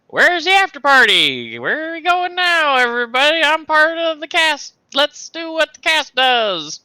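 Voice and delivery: high, loud, overeager party talk; a socially awkward cast member begging for an after party. Acted. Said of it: "Like, socially awkward".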